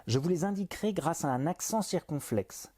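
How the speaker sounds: ordinary talk, nothing marked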